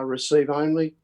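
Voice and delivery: ordinary talk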